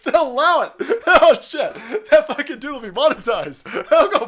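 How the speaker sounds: high, loud laughing delivery. Real, not acted. Laughing at the ranter posting to YouTube, high and loud. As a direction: crack up while talking.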